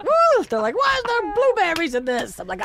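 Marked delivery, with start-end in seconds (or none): 0.0-0.4 high, loud fake whoop; mock climax whoop. Comic showing his yogurt trick, high mock whoop. 0.8-2.4 very high, slow put-on complaint; mock outraged partner. Comic voicing the partner hit with yogurt, high mock outrage.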